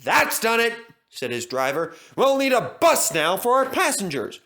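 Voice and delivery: resigned. Acted. Driver gives up on engine.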